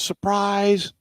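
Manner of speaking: high mock yell of "Surprise!"; retold surprise shout. Family springing the new car on him, high mock surprise shout. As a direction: pitch it high like the family jumping out. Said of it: "My family jumps out and yells".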